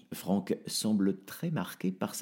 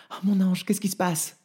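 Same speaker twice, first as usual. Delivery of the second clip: frightened mother; 11 semitones higher, 4 dB louder than usual. Acted. Béa, mère affolée pour son fils.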